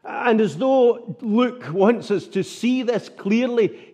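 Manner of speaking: normal talking voice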